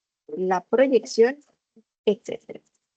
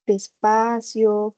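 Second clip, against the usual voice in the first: slow; much slower than usual. Shown as a demonstration. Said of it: "Tenemos que hablar".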